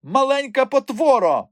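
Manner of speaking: high, loud venomous cry; furious hissed insult. A narrator's reading. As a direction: hurl the insult in a high, seething voice. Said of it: "просичала вона". (люте високе шипіння)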